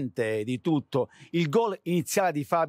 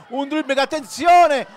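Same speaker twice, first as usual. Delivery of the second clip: much higher and louder rising call; breathless italian goal commentary; 12 semitones higher, 13 dB louder than usual. Acted. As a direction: build to a shout at the shot. Build-up to a shot.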